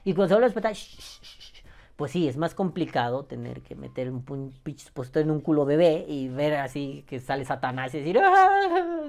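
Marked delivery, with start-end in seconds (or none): 0.7-1.6 soft unvoiced shushing, like rocking a baby; whispered shh to a fussy infant. Hushing the baby again. 8.2-9.1 a high, loud, hammed-up cry of disgust; high, loud fake yelp of horror; mock horrified cry. Reacting to baby's diaper mess.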